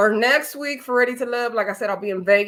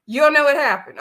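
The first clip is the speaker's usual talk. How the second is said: higher, louder retold challenge; mock disbelief; 6 semitones higher, 5 dB louder than usual. Challenging a cast member's convenient excuse, mock incredulous. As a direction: call her out in mock disbelief.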